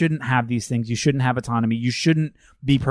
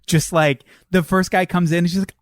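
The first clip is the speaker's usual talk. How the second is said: ordinary delivery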